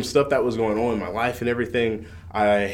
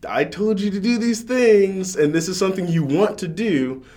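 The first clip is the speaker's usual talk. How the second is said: God's urging, retold; 10 semitones higher, 4 dB louder than usual. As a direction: voice an exasperated reminder from above, pitched up. Voicing God's urging in retelling.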